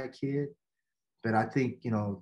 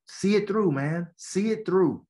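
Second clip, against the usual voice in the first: insistent urging; 5 semitones higher, 4 dB louder than usual. Retold or imitated. Coach urging player to stay.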